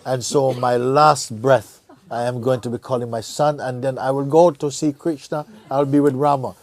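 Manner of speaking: pleased excitement. Retold or imitated. The man boasting of his scheme to himself, excited. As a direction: sound delighted with your clever plan.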